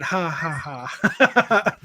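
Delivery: very slow, separated ha-ha-ha; deadpan written laugh. Shown as a demonstration. Defending how the chat wrote it, slow read-out laugh. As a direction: spell the laugh out slowly, word by word. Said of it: "That's how he wrote it".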